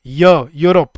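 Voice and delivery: य then यूरोप, y sound pushed out loud; sound demo. Shown as a demonstration. Teacher showing य at the start of यूरोप, loud. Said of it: "यूरोप बोल के देखो एक बार".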